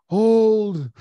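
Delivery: high, loud drawn-out mock yell; all-caps shout. Streamer reads Jordan's all-caps chat message, mock yell. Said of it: "all caps".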